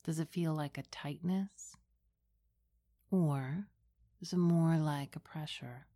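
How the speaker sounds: hushed and unhurried, close to the ear; right in your ear. Acted. Leads a guided meditation.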